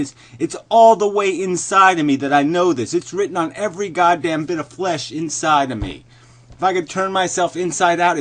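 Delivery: loud, high-pitched ranting conviction; vehement insistence. Real, not acted. Insisting they know it to the core, vehement. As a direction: pound it out as absolute certainty. Insisting furiously on his certainty.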